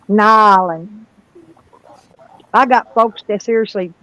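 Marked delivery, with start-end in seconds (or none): 0.1-0.9 "Nolens" as NAH-lenz in a put-on local voice; Louisiana pronunciation. Shown as a demonstration. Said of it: "I know how to speak Louisianian".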